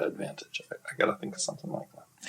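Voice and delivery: whispered aside to himself, fast and very soft; muttered whisper. Real, not acted. Writer muttering to himself about his own adaptation, whispered. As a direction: drop to a breathy murmur as if thinking aloud.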